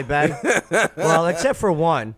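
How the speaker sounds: laughing through the words. Real, not acted. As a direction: chuckle while saying it. Laughing while joking.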